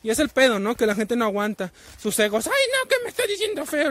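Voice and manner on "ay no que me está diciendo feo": high, whiny mock of an offended person. Mocking people who can't take criticism, in a high whiny voice. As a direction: whine it like someone wounded by criticism.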